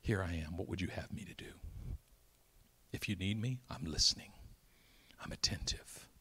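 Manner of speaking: soft breathy whisper, low; whispered prayer. Acted. Preacher voicing a quiet prayer to God in a whisper. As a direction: pray it under your breath.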